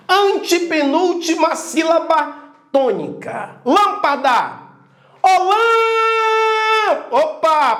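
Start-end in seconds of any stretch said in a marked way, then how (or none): 5.2-7.1 high, held shout on "lã"; calling out the syllable. Shown as a demonstration. Teacher showing the class the tonic syllable of lâmpada, calling out. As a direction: holler it, sustaining "lã". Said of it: "perceba que esse lã".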